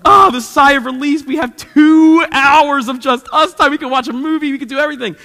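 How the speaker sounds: loud, relieved parent's exhale; hammed-up joy at nap time; a loud mock sigh of freedom; mock relief. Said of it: "sit down and go".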